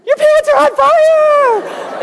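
drawn-out, high-pitched full yell; yelling husband. Retold or imitated. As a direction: holler it in alarm, stretching the words. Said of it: "he's yelling".

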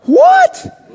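high, loud laughing "What?"; laughing shocked outburst; blurted shock. Retold or imitated. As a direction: blurt it out in startled disbelief. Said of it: "I blurted out".

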